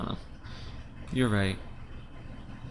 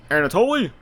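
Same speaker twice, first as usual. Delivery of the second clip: throat clear and call; 11 semitones higher, 8 dB louder than usual. Acted. Loud throat clear, calling.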